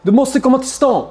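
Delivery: high, loud, urgent cousin on the phone; pressing him to come to town; a hint of a hushed warning over the phone; urgent phone call. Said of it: "han försökte säga någonting".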